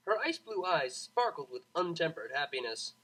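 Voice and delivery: plain reading voice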